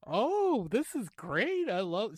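high-pitched imitation of a delighted clerk; gushing enthusiasm. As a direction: coo over it as the clerk did. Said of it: "she was all into it".

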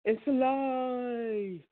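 high, long drawn-out monster-movie cry; horror movie line. Retold or imitated. Quoting a horror movie line to the flock, hammed-up eerie cry. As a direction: stretch it out like a horror film. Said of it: "and it said".

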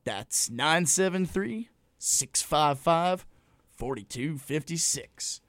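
over enunciating. Shown as a demonstration. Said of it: "Say it one more time, slowly".

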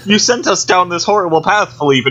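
Maurice complaining. Retold or imitated. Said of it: "He's, he's like".

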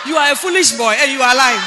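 loud rebuke with a laugh in it; a loud laughing telling-off; laughing scolding shout. Retold or imitated. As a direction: scold him, half laughing. Retold scolding of young man.

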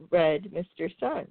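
usual speaking voice

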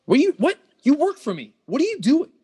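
high shocked yell at employee; yelling in disbelief. Acted.

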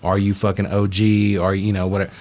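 normal talking voice

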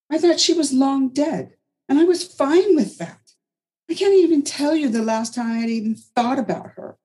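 high, bitter outburst; bitter Anna. A narrator's reading. Anna railing at Margaret about Isabeau, high and bitter.

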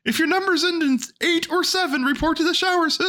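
very high cartoon mouse voice; Mickey Mouse. Host attempts the cartoon mascot making an announcement, high squeaky impression. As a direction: pipe it out in a sustained falsetto squeak. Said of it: "I wish I could do a Mickey Mouse".

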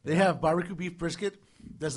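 normal talking voice

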